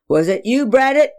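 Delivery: father pressing his son, angry; accusing father. Acted. Father accusing his son Braddock, sharp and loud. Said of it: "he barked angrily".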